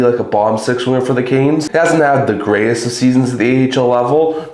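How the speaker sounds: ordinary talk, nothing marked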